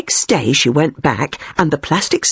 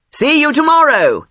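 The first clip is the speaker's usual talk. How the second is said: calling out; 11 semitones higher, 3 dB louder than usual. A narrator's reading. Psychiatrist to patient as she left, calling out. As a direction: call it brightly after someone leaving. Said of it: "shouted the psychiatrist to her as she left".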